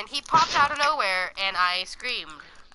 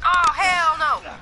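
drawn-out shout; 4 semitones higher, 7 dB louder, slower than usual. Acted. Dramatic refusal.

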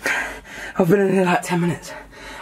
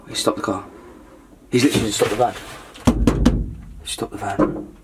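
low, furtive undertone; hushed alarm; 9 semitones lower, 3 dB quieter than usual. Acted. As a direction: report it under your breath. Hiding his talk as van stops.

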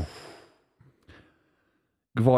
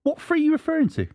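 very high put-on questioning voice; playfully wounded high-pitched query; mock innocent. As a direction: ask it meekly, pitched way up. Repeats question after being called stupid.

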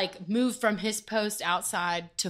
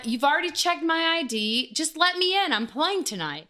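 pitched up, frustrated insistence; exasperated demand; 7 semitones higher than usual. Retold or imitated. To the bouncer, exasperated and pitched up. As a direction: push back, fed up and pleading. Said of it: "I was like".

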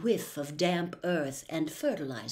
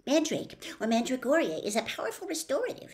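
quick, higher know-it-all recitation; eager swot reciting; Hermione voice; 6 semitones higher, faster than usual. Acted. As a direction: rattle it off like the textbook.

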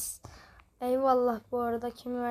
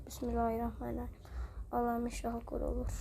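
quick muttered prayer; 8 dB quieter, faster than usual. Real, not acted. Quick prayer before shot.

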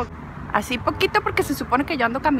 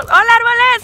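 saludo alegre; 9 semitones higher, 8 dB louder than usual. Pretend. Saluda a los árboles del parque.

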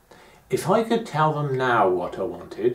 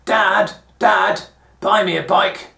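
higher, louder calling out for dad; shouting to dad; 7 semitones higher, 6 dB louder, slower than usual. Retold or imitated. Child shouting to his dad for a bike, loud and high. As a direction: call out across time, pleading for a bike. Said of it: "shout at it".

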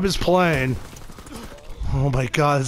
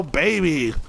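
ordinary delivery

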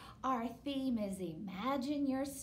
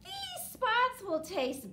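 goat voice; 8 dB louder, slower than usual. Acted. Goat answers high.

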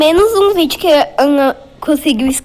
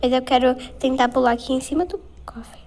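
whispering; 6 dB quieter than usual. Real, not acted. Sneaking in a horror game.

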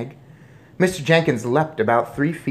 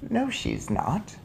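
high, soft, slow reply; unruffled grandmother; 7 semitones higher, 5 dB quieter, slower than usual. A narrator's reading. Grandmother corrects father, unruffled. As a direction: contradict him gently.

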